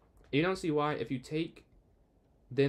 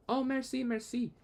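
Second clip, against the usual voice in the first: delighted thanks; 12 semitones higher than usual. Real, not acted. Thanked for speaking French well.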